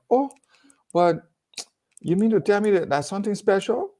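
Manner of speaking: high, amazed question; retold amazement, pitched high; mock surprise.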